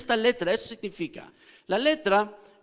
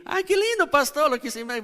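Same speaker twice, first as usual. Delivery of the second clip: much higher, mocking sing-song; sarcastic churchgoer; 7 semitones higher, faster than usual. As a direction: scoff like a sarcastic listener. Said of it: "parece así como que".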